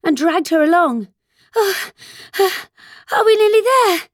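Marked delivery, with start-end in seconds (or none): 1.5-4.1 high, breathless question between gasps; panting. A narrator's reading. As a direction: pant it out while running. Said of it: "Alice managed to pant out".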